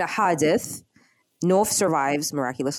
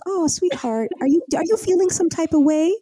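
high, sugary mock-innocent coo; fake sweetness; 10 semitones higher than usual. Wife mocking her jealous husband, high sugary coo. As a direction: act sweet to dodge the question. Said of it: "She's acting totally cold".

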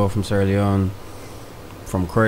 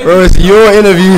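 loud teasing; 10 semitones higher, 13 dB louder than usual. Real, not acted. Teasing reply, much louder.

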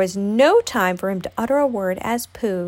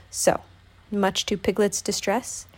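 ordinary delivery, nothing marked

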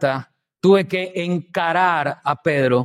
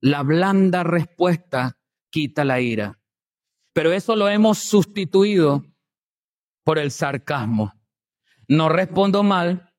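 usual speaking voice

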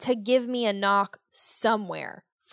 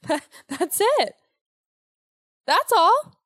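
laughing; 9 semitones higher, 5 dB louder than usual. Real, not acted. Laughing at own paranoia.

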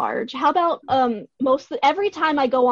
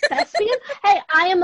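excited; 7 semitones higher, 4 dB louder than usual. Real, not acted.

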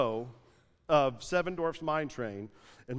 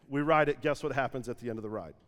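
ordinary talk

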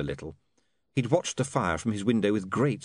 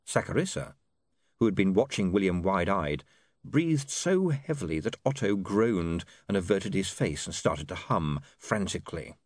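ordinary delivery, nothing marked

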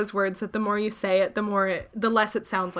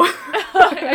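laughter; 8 semitones higher, 6 dB louder than usual. Real, not acted. Both laughing.

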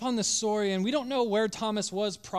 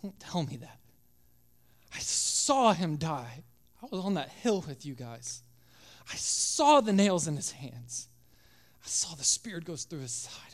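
choked up; 3 semitones lower than usual. Acted. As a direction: hold back tears as you say it. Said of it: "Got a lump in his throat".

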